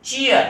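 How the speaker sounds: चीयर said with a clear ch at the start; sharp teacher's ch in चीयर; ch sound demo. Shown as a demonstration. Teacher shows students चीयर starts with a ch sound. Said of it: "च की आवाज़ दे रहा है".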